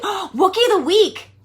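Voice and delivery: gasping, thrilled, drawn out; delighted discovery of the slogan; excited gasp. Shown as a demonstration. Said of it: "maybe Wookiee of the Week".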